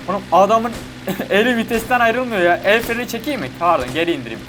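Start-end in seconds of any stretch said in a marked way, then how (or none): none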